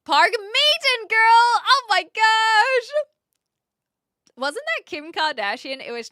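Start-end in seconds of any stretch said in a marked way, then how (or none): 0.0-2.9 high, loud gushing exclamation; excited squeal. Real, not acted. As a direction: squeal it out, totally starstruck. Reacts to something on stream.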